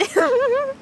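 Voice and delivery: high-pitched laughter. Real, not acted. Streamer laughing at own empty head, high laugh.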